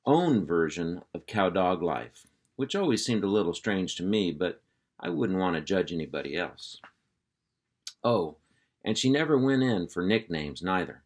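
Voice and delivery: ordinary delivery, nothing marked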